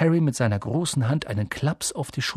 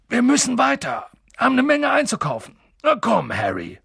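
high, loud hurry-up; booming, urgent summons; calling over the crowd; 9 semitones higher, 4 dB louder than usual. Acted. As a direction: cut through the hubbub to move someone along. Hagrid makes himself heard.

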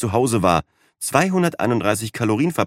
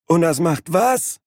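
high, slow, irritated question; fed up and impatient; annoyed; 9 semitones higher, slower than usual. Acted. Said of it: "fragte Wasted Wayne genervt".

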